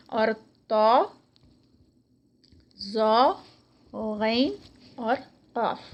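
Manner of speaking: isolated emphatic letters "تو", "زو", "غین", "قاف" with a heavy sound. Shown as a demonstration. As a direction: say "تو", "زو", "غین", "قاف" thick and full. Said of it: "heavy ادا کیا جائے گا، یہ موٹا پڑھا جائے گا". ("تو"، "زو"، "غین"، "قاف" موٹی آواز میں)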